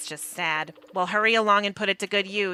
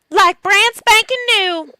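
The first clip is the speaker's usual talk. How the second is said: much higher, louder, slow boast; proud boast; 12 semitones higher, 8 dB louder, slower than usual. Pretend. Answering the shopkeeper with a high, loud, proud boast.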